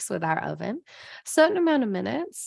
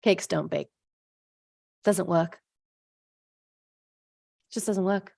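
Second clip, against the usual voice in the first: defeated giving up; 3 semitones lower than usual. Retold or imitated. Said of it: "you're like".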